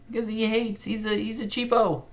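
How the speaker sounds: high, put-on silly voice; mock-squeaky jab at a tightwad; playful teasing. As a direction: pitch it way up to poke fun. Teasing a miserly sitcom character.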